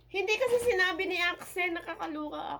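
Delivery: high, loud, fast outburst; high-pitched excited rush; excited exclaiming. Real, not acted. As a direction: blurt it out, overwhelmed by the surprise. Overwhelmed by surprise gift.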